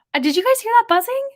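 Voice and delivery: high, fast, squeaky question; high-pitched mock alarm. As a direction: panic playfully about the buzzing. Joke after bee voice.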